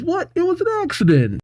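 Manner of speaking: high, rushed self-defence; startled protest. Acted. Accused man protests to lady.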